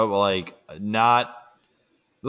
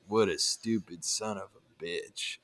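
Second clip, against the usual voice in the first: muttered low and much softer, almost to himself; under his breath; 4 semitones lower, 12 dB quieter than usual. Retold or imitated. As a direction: mutter it as a private aside nobody should hear. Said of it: "under his breath".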